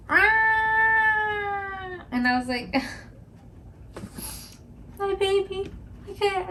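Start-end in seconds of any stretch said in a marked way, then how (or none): 0.1-2.0 a high, thin yelp like a hurting dog; imitated dog yelp. Mimicking her dog's cry after he peed, imitated yelp. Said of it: "he was like". 5.0-6.5 high, strained near-whimper; distressed. Retold or imitated. Owner reacting to her dog's cry, distressed whimper.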